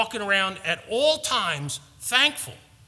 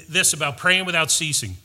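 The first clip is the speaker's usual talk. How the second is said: ordinary talk, nothing marked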